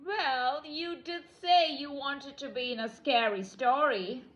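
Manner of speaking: usual speaking voice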